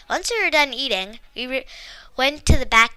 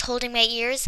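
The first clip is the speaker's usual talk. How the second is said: plain reading voice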